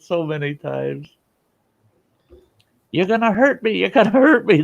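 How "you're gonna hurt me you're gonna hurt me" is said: high, louder panicky whine; mock yelling patient. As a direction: imitate a patient yelling in fear. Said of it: "They're yelling at you".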